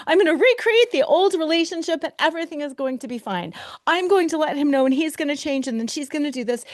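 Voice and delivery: quick, eager inner voice spinning plans; frantic false hope. Retold or imitated. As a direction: race through the fantasy, lightly hopeful. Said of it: "false hope".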